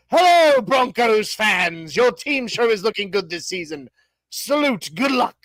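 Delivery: reading a fan message in a mock British accent. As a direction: play a pompous British king greeting fans. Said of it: "I have to read it in a British accent".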